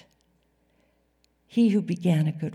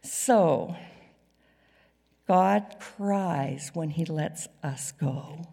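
ordinary talk

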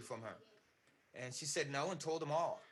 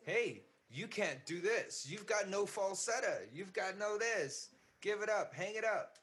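higher, louder imagined heckler; mock scolding; 6 semitones higher, 4 dB louder than usual. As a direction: lay into the singer like a dismissive critic. Acted heckler berating a singer.

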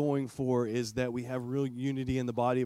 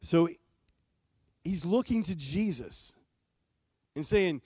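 normal talking voice

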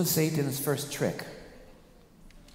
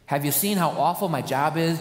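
ordinary talk

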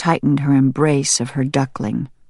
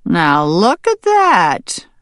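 pleased farmer; 4 semitones higher, 4 dB louder, much slower than usual. Acted. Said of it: "The farmer was pleased".

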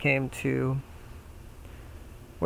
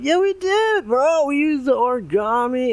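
frat bro; 12 semitones higher, 5 dB louder than usual. Acted. Pig one insists.

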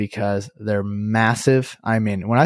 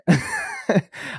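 higher, breath-only laugh; 5 semitones higher, 3 dB quieter than usual. Real, not acted. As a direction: laugh at your own disbelief. Laughing at his own astonishment.

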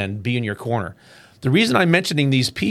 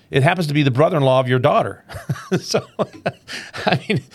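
fast, laughing through the words; 3 semitones higher, faster than usual. Real, not acted. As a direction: laugh out the punchline. Punchline of a retold joke.

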